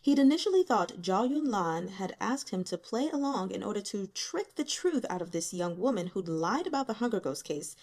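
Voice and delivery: usual speaking voice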